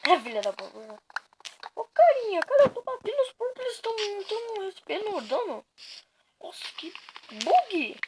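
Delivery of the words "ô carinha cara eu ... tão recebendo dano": excited. Real, not acted. Hitting pigs, they take damage.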